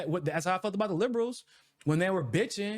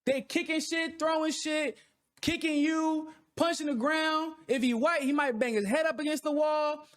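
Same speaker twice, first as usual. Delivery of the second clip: animated tantrum description; 10 semitones higher, slower than usual. Real, not acted.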